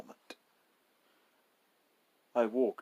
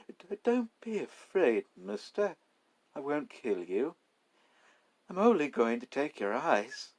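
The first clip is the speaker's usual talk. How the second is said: eerie reassurance; 6 semitones higher, 3 dB louder than usual. Acted. Robber calmly reassuring victim.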